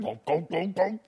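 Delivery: high mock chugging noise; silly drinking sound; glugging sound effect. Shown as a demonstration. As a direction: chant the gulps up high like downing a beer. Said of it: "make sound effects like that".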